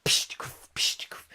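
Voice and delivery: unvoiced puffing chuffs; soft whispered engine sounds; steam engine noises. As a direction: puff like a little steam train. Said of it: "Ivor the engine?".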